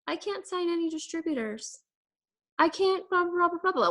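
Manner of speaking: high mocking whine of excuses; mocking a whiny excuse-maker; whiny complaint. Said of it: "But I hate when people say".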